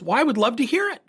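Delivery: high, quick, huffy retort; angry mother. Retold or imitated. Angry mother answering the coach's offer, indignant. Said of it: "She's angry".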